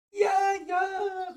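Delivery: viel höher und lauter gerufen; aufgeregter Ruf. Acted. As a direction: jubelnd rufen wie kleine Mäuse. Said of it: "riefen Willi". (Mäusejungs rufen der Fee begeistert zu, hoch und laut)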